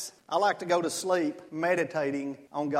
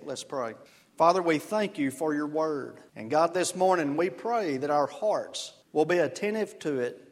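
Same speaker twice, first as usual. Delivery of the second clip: prayer; 4 semitones lower than usual. Acted. Pastor opens with prayer.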